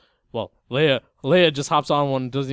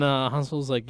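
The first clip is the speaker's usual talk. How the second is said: ordinary talk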